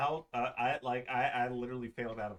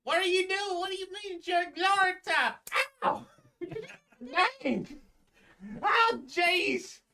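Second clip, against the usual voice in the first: much higher, fast, yelping protest; indignant golem; 6 dB louder than usual. Acted. Second golem protesting as he gets hit, high and yelping. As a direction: squeal in outrage while being punched. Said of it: "you hear someone else go".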